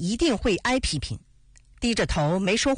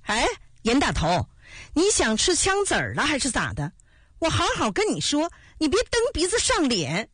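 higher, sharp angry call at the shepherd; shouting; 7 semitones higher than usual. A narrator's reading. Officer loses his temper at the shepherd, higher angry shout. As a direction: lose patience and shout a warning. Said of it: "喊道".

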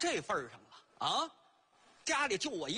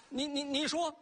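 usual speaking voice

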